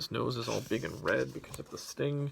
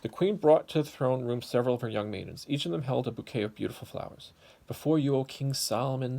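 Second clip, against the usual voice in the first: louder, animated storybook telling; lively story narration; 5 dB louder than usual. A narrator's reading. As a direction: tell it big for the kids. Reads story to children.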